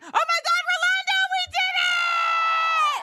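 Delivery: high, loud burst of celebration; excited shout. Real, not acted. Streamer hailing viewers from London, excited shout. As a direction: yell it out overjoyed, stretching the end.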